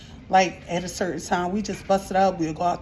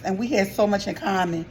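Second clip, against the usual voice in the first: ordinary talk, nothing marked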